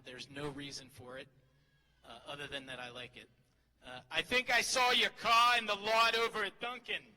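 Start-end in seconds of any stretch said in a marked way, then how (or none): 4.1-7.0 loud, higher Boston accent impression. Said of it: "I'd like to do an impression".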